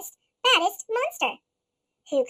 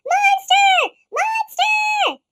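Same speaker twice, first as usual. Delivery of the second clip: high, loud call; calling shout; 12 semitones higher, 8 dB louder, much slower than usual. Acted. Trio calling after Monster, shouting.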